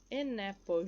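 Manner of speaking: AN APPLE blended as "a-napple"; AN APPLE joined with no break; linked sounds. Shown as a demonstration. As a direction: carry the N of AN into the vowel of APPLE. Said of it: "junto aqui o som do N".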